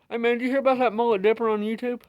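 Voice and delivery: fast, high excited bro; gossiping bro, high and quick; dude voice. Pretend. Acted dialogue between two dudes.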